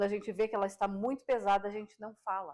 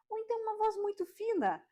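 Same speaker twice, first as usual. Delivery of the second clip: much higher, thin put-on voice; 12 semitones higher than usual. Shown as a demonstration. Voice coach showing viewers a thin voice, much higher. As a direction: jump way up into a thin little voice. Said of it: "Olha quantos tons de voz diferentes".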